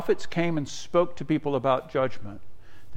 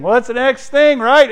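higher and louder, pleading; rising exasperated cry; 11 semitones higher, 10 dB louder than usual. Acted. Preacher crying out to the congregation, exasperated. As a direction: cry it out like you can't take more.

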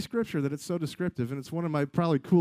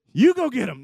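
high, loud frantic order; hammed-up squabbling shout; panicked ordering; 6 semitones higher, 8 dB louder than usual. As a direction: shove the job onto someone, loudly. Acted argument between freaking-out disciples.

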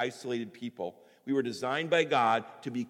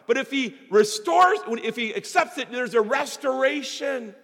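loud, high fervent preaching; full-force preaching; 9 semitones higher, 7 dB louder than usual. Acted. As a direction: drive it home to the congregation.

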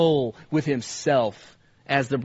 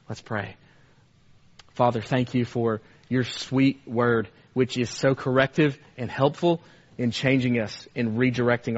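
prayer; 4 semitones lower than usual. Real, not acted. Leads the closing prayer.

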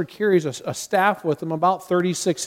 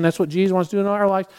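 normal talking voice

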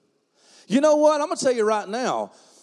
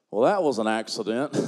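deep, mocking voice of a scoffer; mock dismissive; 12 semitones lower than usual. Preacher mocking a scoffer, deep and dismissive. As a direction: sneer it out like a fool who denies God. Mocking unbeliever under oak tree.

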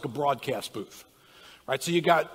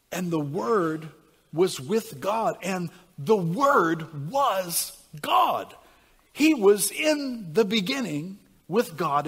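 slow, weighty reading building louder; scripture reading; 3 semitones higher, 5 dB louder, slower than usual. Acted. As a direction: let each phrase land, rising to the end. Reads John chapter one.

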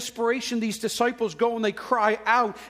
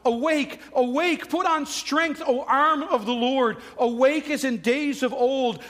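raised, high-pitched prophetic call; crying out; 4 semitones higher, 3 dB louder than usual. Acted. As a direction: call out to God to rouse Him. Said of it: "Isaiah cried out".

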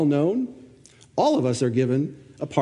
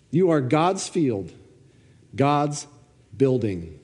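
measured, formal Bible reading voice; scripture reading; 3 semitones higher than usual. Acted. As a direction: read the passage slowly, with weight. Pastor reads the scripture passage.

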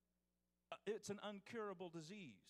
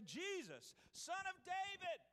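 high pleading cry for mercy; a beggar crying out to Jesus from the roadside; 10 semitones higher than usual. Acted. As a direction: call out desperately, pitch leaping up. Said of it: "he began to cry out and say".

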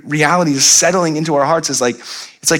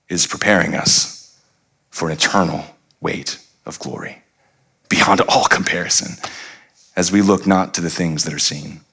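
scripture reading; 11 semitones lower than usual. Acted. Preacher reading Paul's words on suffering, hushed and reverent. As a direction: let each phrase of the verse sink in.

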